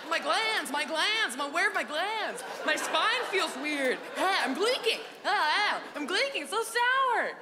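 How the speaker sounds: high, hammed-up panicked wailing; mock sour agony. Kid reacting to sour gumballs, high mock agony. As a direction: wail as if the mouth is seizing up. Acting kids overwhelmed by sour candy.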